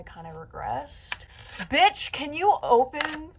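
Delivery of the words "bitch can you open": high, loud, drawn-out giddy demand; excited. Real, not acted. Opening the new glue sticks, over-excited. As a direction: stretch the words with giddy impatience. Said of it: "why I'm so excited about glue sticks".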